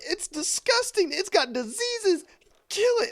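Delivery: hammed-up mom screaming about a mouse; panicked mom. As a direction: shriek like a terrified mother. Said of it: "She's like".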